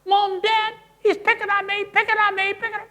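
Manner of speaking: put-on high, loud whining kid yelling from the back seat; shrill-free but piercing high whine of a tattler; complaining to parents from the back seat, a high whiny wail; whiny tattling child. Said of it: "From the back say".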